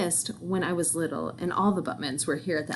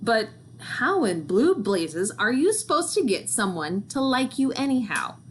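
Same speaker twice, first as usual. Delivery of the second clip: plain reading voice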